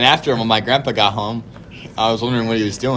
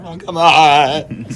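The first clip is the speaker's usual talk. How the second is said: drawn-out mock whine, a bit higher; slow, put-upon whine; exasperated protest; 4 semitones higher, 4 dB louder, much slower than usual. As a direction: groan it out in reluctant complaint. Protesting another shot.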